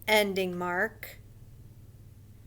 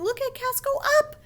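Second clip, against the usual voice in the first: very high, thrilled shout-out; excited friend; 4 dB louder, faster than usual. Acted. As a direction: cheer on a friend, pitched way up. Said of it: "what her friends are saying".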